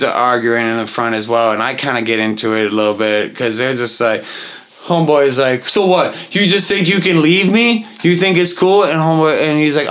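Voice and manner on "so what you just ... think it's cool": a slightly raised, heated challenge; mock argument. Man outside the club arguing with his date, mildly heated. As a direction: confront him like a jilted date.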